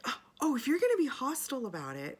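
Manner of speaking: high, loud, affronted mimicry; mock indignation. Conductor accusing passenger of hostility, mock indignant.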